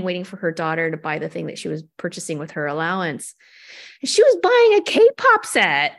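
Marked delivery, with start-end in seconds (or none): 4.0-5.9 bursting high and loud with delight; can't hold back the thrill of it; excited. Real, not acted. Guest tells the host about the Target shopper, thrilled, high and loud.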